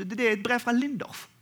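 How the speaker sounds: normal talking voice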